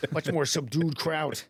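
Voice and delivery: normal talking voice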